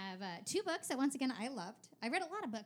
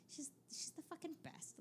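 whispered; 5 semitones higher, 13 dB quieter than usual. Real, not acted. Gushing about favorite author.

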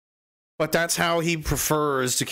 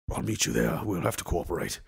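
much lower, softer put-on voice; low grave officer; 10 semitones lower, 6 dB quieter than usual. Answering the challenger on the field, low and grave. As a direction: agree in a low, hushed tone.

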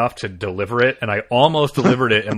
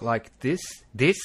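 Kiwi accent; slower than usual. Shown as a demonstration. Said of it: "easier to do a Kiwi accent".

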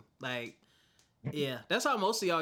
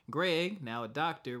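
ordinary delivery

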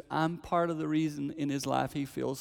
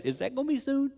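put-on much higher child voice; grandson's high worried question; 14 semitones higher than usual. Five-year-old grandson asking about death, high child voice. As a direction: ask it like a small boy. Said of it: "he said".

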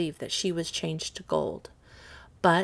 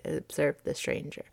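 ordinary delivery, nothing marked

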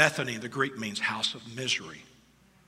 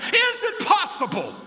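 high, slow pleading question; rising plea; 8 dB louder than usual. Shown as a demonstration. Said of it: "I'm just asking".

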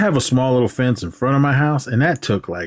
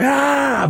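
sudden high yelp of pain; a short high cry of pain. Retold or imitated. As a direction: yelp like a hurt knee just got rubbed. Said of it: "He was like".

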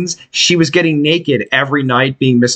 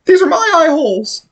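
silly voice. Pretend. Joking about eye holes.

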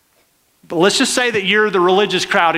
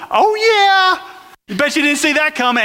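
gloating rowdy crowd; 7 semitones higher than usual. Acted. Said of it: "the rowdy crowd's going".

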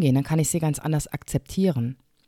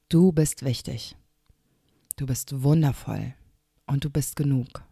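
guided meditation; 3 semitones lower, 4 dB quieter than usual. Acted. Podcast host guiding her listener through a calm breath, softly. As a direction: gently guide a breath, hand on heart. Leads a heart breathing moment.

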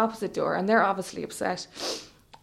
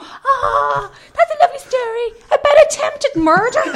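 high, loud cooing on the edge of laughter; sing-song gushing, pitched way up; gushing mock sweetness; 8 dB louder than usual. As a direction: coo as if it's the sweetest tale. Retelling their gushing reaction to crime.

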